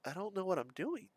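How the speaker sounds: imitated small, bewildered murmur; kid's inner thought. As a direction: wonder quietly to yourself as a child. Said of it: "I'm thinking to myself as a kid".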